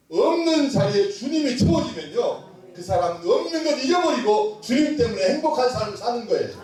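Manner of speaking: high, emphatic pulpit delivery; forceful preaching. Real, not acted. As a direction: press the message on the congregation.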